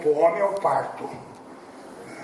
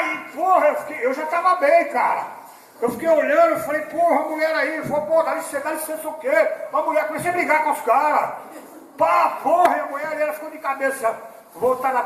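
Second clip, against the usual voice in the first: agitated retelling; 10 semitones higher, 4 dB louder than usual. Retold or imitated. Agitated retelling of hospital fight.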